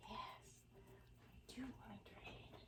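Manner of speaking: breathy little whisper as the dog. Pretend. Owner voices her dog's eager answer, whispered. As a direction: hush it out like the dog agreeing.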